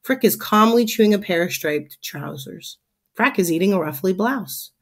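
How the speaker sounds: usual speaking voice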